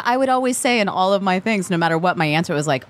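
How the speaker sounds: ordinary talk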